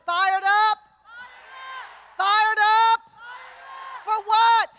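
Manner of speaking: loud call to the crowd; rally call. Real, not acted. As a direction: rouse the crowd again.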